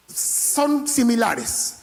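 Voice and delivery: ordinary talk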